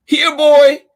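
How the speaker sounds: high, loud call to a dog; shouted. Acted. Charlie calls the lost dog over, loud high shout. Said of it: "shouted Charlie".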